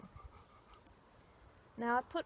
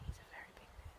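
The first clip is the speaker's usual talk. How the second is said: whispered, quieter and unvoiced; hushed whisper; 11 dB quieter, much faster than usual. Real, not acted. Medium whispering about the big man behind her. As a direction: drop to a whisper as if he might hear.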